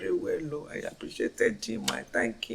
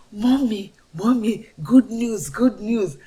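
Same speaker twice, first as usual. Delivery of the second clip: higher, louder eager announcing; excited son; 4 semitones higher, 6 dB louder than usual. Retold or imitated. Son telling his mother the good news, excited and higher.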